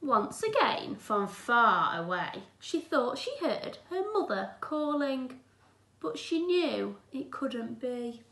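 put-on gentle, clear read-aloud for children; teacher reading a picture book aloud; storybook reading. A narrator's reading. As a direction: read it like a bedtime story to the class.

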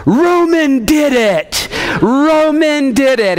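high, drawn-out mock wail; imitated crying child. Boy tells on his brother over broken Legos, mock crying. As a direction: wail like a tattling, tearful kid. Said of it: "he's crying, and he's like".

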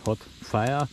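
ordinary delivery

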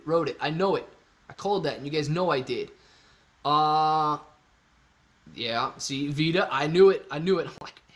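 ordinary delivery

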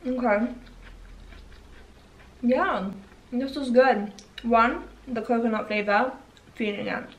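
eating a thin cookie; chewing while talking. Real, not acted. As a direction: mouth partly full. Seen 2: Holds Oreo thin, mouth working while talking, bites again at 1.6.

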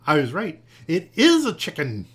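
loud, rising triumph; exclaiming. A narrator's reading. Marilyn sees the creature hatch, exclaiming. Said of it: "exclaimed Marilyn".

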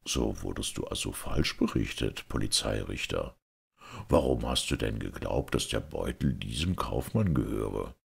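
low, quiet ruler's voice; reproving Caliph. A narrator's reading. Caliph questioning the police judge, quietly reproving.

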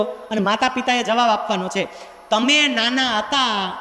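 ordinary delivery

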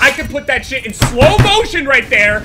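loud, high, rising indignation; heated protest. Real, not acted. Gamer insisting the block was real, heated protest. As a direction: argue it at the top of your voice.

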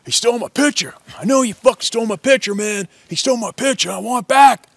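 high, loud furious accusation; enraged yelling. Acted. Hunter accusing others over his lost picture, enraged yell. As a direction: yell it like a raging bully.

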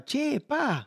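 a light, laughing take on his wife; imitating his wife. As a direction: tease it like his Dominican wife saying "Che, pa". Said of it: "me decía".

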